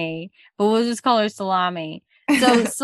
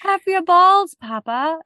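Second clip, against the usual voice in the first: bratty daughter yell; 9 semitones higher, 4 dB louder than usual. Acted. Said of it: "it could be like".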